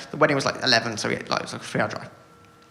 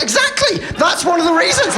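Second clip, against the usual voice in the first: mock high reply; 14 semitones higher, 4 dB louder than usual. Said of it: "I was like".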